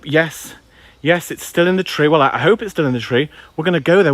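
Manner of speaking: normal talking voice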